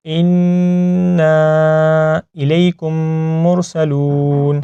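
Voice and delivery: slow, drawn-out Quranic recitation. Shown as a demonstration. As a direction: recite slowly with long held vowels. (ٹھہر ٹھہر کر تلاوت)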